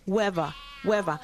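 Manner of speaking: "weather" said as "wever"; Cockney "wever". Shown as a demonstration. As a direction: turn the TH of "weather" into a V. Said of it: "se pronuncia como una V".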